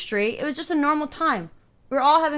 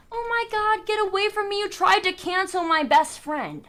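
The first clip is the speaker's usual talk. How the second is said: high, singsong taunting voice, slower on the accusation; playful Minx taunt; 9 semitones higher than usual. Retold or imitated. Minx running away from him at the party, high playful taunting. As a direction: tease like a kid running off shouting an accusation. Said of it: "He runs away playful like this".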